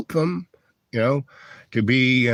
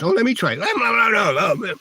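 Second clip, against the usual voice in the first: much higher whiny no, no; childish squabble; 10 semitones higher than usual. Retold or imitated. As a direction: play the bickering kid refusing. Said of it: "they're like five-year-olds".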